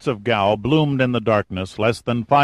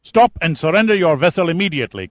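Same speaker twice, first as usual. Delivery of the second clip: angry, higher, louder and fast; spat out; 8 semitones higher, 6 dB louder, faster than usual. Acted. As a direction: spit the order in contempt. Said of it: "Kazim spat".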